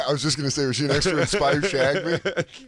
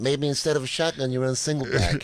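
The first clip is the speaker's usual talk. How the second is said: Chris Italian. Acted. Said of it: "It's also my Chris Italian impression".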